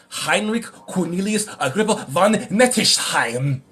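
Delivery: "Heinrich Cornelius Agrippa von Nettesheim" slow and throaty, in a hammed-up German accent; mock German accent. Shown as a demonstration. Streamer reading a German occultist's name aloud, in a mock German accent. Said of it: "I had to read it like that".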